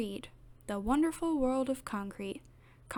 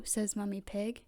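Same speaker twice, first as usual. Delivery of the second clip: ordinary delivery, nothing marked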